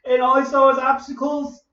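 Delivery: usual speaking voice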